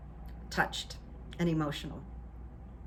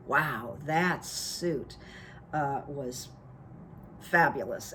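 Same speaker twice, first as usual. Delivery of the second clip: lower, hushed admiring wow; awed wow; 6 semitones lower, 5 dB quieter, slower than usual. Retold or imitated. Admiring Barack's suit, a low hushed wow. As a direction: savour the word fabulous low. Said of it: "I just like".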